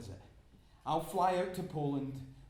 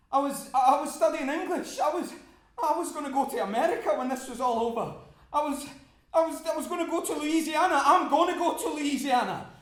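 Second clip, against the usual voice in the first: much higher and louder, laughing keep-awake chatter; frantic cheer; 10 semitones higher, 10 dB louder than usual. Acted. Keeping a dying man awake.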